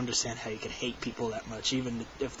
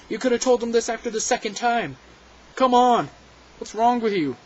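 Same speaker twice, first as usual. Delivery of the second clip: higher and louder, fed-up scolding; exasperated outburst; 9 semitones higher, 6 dB louder than usual. Retold or imitated. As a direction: let the frustration burst out at her. Indignant at the cashier's delay.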